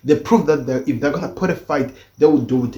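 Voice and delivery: ordinary talk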